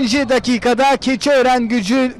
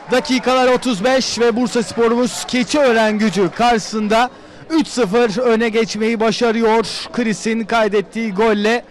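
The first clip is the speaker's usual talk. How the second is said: normal talking voice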